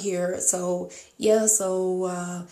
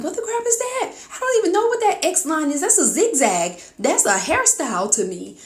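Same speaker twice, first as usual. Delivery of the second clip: high, startled what-the-crap; confused tourist seeing UK road signs; baffled; 8 semitones higher, 4 dB louder than usual. Retold or imitated. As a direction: squeal at the strange road signs. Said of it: "I'm like".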